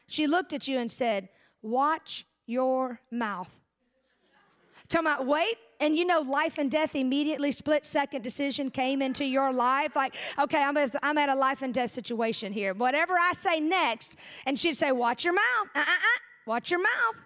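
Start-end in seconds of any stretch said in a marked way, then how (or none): 1.6-3.5 very slow, lower warning, word by word; mother's warning. Retold or imitated. Mama looks at her little child and warns, slow and stern. 14.9-17.2 quicker mock-scolding mom with a lilting uh-uh-uh; mother's scolding. Mama catches her child about to talk back, mock scolding.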